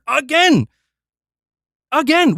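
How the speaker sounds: loud, exasperated repeated cry; fed-up streamer shouting at the screen. Real, not acted. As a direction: yell it out in disbelief. Exasperated rant outburst.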